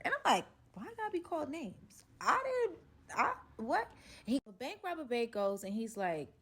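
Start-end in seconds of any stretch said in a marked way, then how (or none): 0.7-3.9 high, offended protest; indignant surprise. Retold or imitated. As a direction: sound hurt and confused. Offended at being called names.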